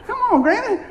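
high, laughing come on; laughing protest. Retold or imitated. Grandson laughing at granny's cheating, pitched high.

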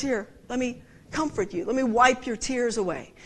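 soft, low comforting voice; gentle stranger offering a tissue; tender comfort. Retold or imitated. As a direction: soothe someone in tears.

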